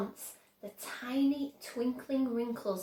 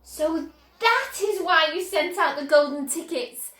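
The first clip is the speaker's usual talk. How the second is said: higher, louder realisation; excited cry; 7 semitones higher, 9 dB louder than usual. A narrator's reading. Charlie realising why the tickets were sent, excited cry. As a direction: burst out as it clicks. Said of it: "cried Charlie".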